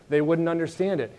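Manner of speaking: ordinary talk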